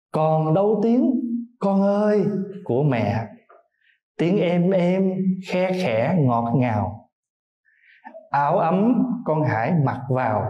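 slow, tender poem reading; poem recitation. Acted. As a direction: recite each line with lingering pauses. Recites mother's words in poem. (ngâm thơ chậm rãi, tha thiết)

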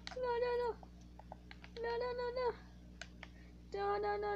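high pleading cries of no, fading away; panicked begging. Real, not acted. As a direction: beg for your life as it fades. Panicked begging while being attacked.

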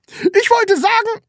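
very high, loud outburst; high-pitched flustered shout; loud blurting. A narrator's reading. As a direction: blurt it out and break off. Landlady tries to correct herself.

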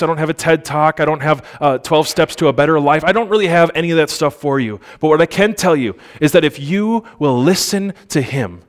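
usual speaking voice

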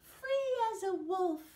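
high, loud, gleeful yelp; yelping with joy. A narrator's reading. Mocha the dog reaching the mountaintop, yelping with joy. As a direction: yelp it out like a dog let loose. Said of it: "he yelped".

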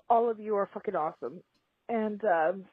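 ordinary talk

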